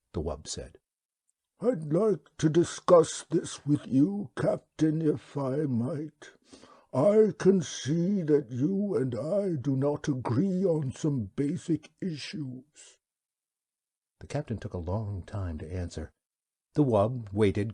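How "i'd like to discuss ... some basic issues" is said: slow, high, unhurried alien creature voice; wub's voice. Acted. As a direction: speak as a placid, courteous old beast. The wub speaks.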